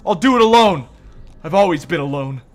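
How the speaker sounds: paranoid outburst. Acted. Reina accusing Tanaka of wanting to hurt her, shouted high. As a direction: scream them back.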